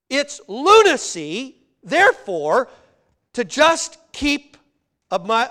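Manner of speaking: loud, measured pulpit delivery; forceful preaching. Real, not acted. Preacher argues that piling up money is lunacy, forceful. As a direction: hammer the point home to the congregation.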